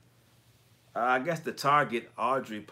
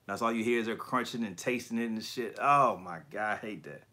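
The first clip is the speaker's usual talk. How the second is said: ordinary talk, nothing marked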